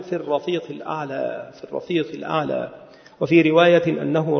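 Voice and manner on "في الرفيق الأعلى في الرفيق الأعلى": quiet, understated deathbed quote; softly quoted last words. Retold or imitated. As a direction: recite the final words softly, with restraint. Quoting the Prophet's dying words.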